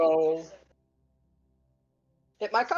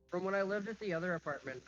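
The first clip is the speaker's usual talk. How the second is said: muffled, softer, echoing bathroom sound; from another room; 10 dB quieter than usual. Real, not acted. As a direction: speak from the bathroom, off mic. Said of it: "Yeah, I'm in the bathroom".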